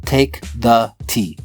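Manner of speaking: very slow, each word set apart; slow, measured quote of a husband's order; deliberate command. Retold or imitated. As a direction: space out every word like a solemn instruction. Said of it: "looked at me and goes".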